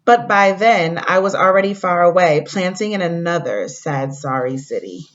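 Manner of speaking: storybook read-aloud to the class; reading to children. Acted. Teacher reading a story to fourth graders, gentle read-aloud. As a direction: read it like a picture book for kids.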